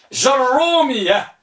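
جرومية starting straight on ja, doubled ر; article dropped. Shown as a demonstration. Teacher dictating the third type, carefully pronounced. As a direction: say جرومية without al, jar-rumiyya. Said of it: "بحذف الهمزة واللام فقط".

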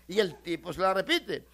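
ordinary delivery

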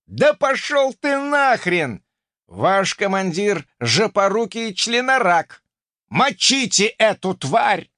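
enemy raging at the thief on the ship; furious cursing. Acted. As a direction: curse him out, dragging it.

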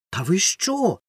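put-on high indignant boy's outcry; boy protesting the accusation; indignant exclamation. A narrator's reading. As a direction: protest in disbelief. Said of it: "вигукнув Ромка".

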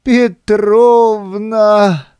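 high, very slow final cry; one last desperate call; desperate shout. A narrator's reading. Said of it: "в последний раз прокричал Синичкин".

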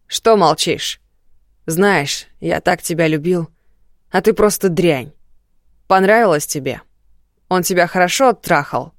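громко, с презрением и яростью; бросай оскорбления со злостью; злой крик. Acted. Муж оскорбляет жену в гневе. (яростный крик)

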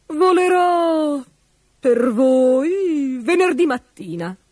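slow, drawn-out royal proclamation; starts high, stately and slow; solemn announcement. A narrator's reading. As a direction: proclaim it grandly, stretching the words. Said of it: "annunciò solennemente".

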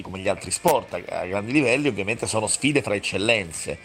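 normal talking voice

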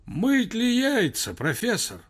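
high-pitched, anxious man far down the line; slow, high, troubled telephone voice; distant, alarmed. A narrator's reading. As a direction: call out a worried question down a bad phone line. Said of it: "дальний мужской голос сказал в ухо встревоженно".

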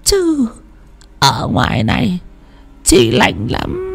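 put-on high, soft, wheedling old woman's voice; coaxing ghost. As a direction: lure him to open the door. Ghost coaxes him to open. (giọng ma dụ dỗ, cao và nhỏ nhẹ)

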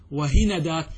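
وهندات as "wa-hi-na-daat", a after the n; middle vowel a. Shown as a demonstration. Teacher gives three dialect forms to students, وهندات as "wa-hi-na-daat". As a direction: say وهندات with a fatha on the nun. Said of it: "بالفتح".

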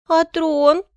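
put-on high, loud girlish whine; plaintive. A narrator's reading. Favourite whines to the emperor about her throne, plaintive. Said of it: "жалобно прошептала эта неугомонная".

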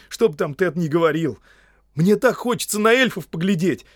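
high, loud, flustered outpouring; excitable gardener's babbling; trembling eager confession. Acted. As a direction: pour it all out, nervous and enchanted. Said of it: "начал Сэм всё ещё дрожащим голосом".

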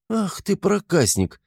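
высоко и медленно, в шутку возмущённо; мягко пожурить друга; шутливое возмущение. A narrator's reading. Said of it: "шутливо возмутился Артём". (шутливо возмущённо)

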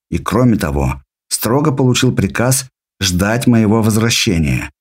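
usual speaking voice

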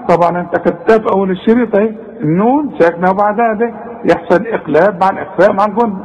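ordinary talk, nothing marked

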